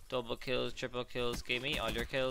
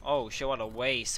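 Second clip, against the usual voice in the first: ordinary talk, nothing marked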